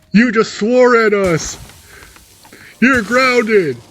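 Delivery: high, loud telling-off; ground him like a cross parent; scolding parent. Retold or imitated. Parents scolding their son for swearing, high and loud.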